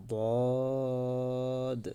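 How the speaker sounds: বদ said full and kept apart from দাল; Arabic letter demo. Shown as a demonstration. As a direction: model বদ with a heavy, distinct sound. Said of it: "তারপরে বর্ণ". (দাল থেকে আলাদা করে বদ উচ্চারণ)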